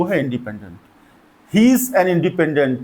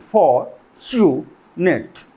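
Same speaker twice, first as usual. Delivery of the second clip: fortunate split slowly as for, chu, net; "fortunate" very slow, a pause between syllables; much slower than usual. Shown as a demonstration. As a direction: break "fortunate" into three separate beats. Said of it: "So three syllable हैं".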